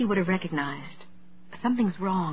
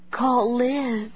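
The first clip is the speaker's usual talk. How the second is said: pleading; 4 semitones higher than usual. A narrator's reading. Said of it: "she pleaded".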